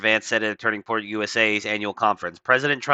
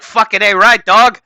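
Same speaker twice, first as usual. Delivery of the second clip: higher, much louder hammed-up bro shout; mock street bro; 8 semitones higher, 9 dB louder than usual. Host mocking Usha Vance cheering her husband, hammed-up bro shout. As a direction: ham up a street bro hyping his buddy. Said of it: "'cause that's exactly how she talks".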